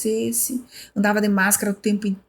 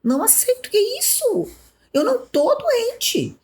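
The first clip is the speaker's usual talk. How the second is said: much higher, slow insistence; indignant protest. Retold or imitated. To cousin Francine, refusing the diagnosis, much higher. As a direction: squeal out the refusal.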